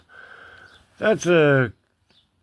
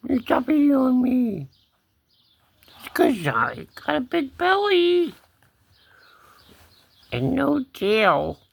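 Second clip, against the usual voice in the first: put-on high, childlike-spelled kitty talk; cat voice; 10 semitones higher than usual. Cat explaining his big belly, high cat voice. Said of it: "the only cat voice I have".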